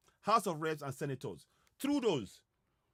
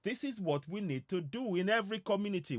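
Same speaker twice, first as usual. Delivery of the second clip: normal talking voice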